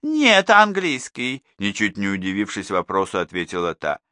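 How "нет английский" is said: higher, louder, slow woman's reply; neighbour's answer. A narrator's reading. Neighbour answers Danilov about the teacher's subject. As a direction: answer matter-of-factly as the neighbour.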